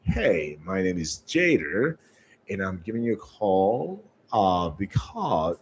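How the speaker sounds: low, dragging sales call; too slow, boring. Shown as a demonstration. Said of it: "Don't make it too slow".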